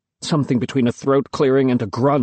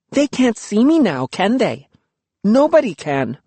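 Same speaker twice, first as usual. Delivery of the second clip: put-on high, bright-pitched defiant girl; 9 semitones higher than usual. Luki defying the ancients, high gleeful girl. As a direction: toss it off gleefully.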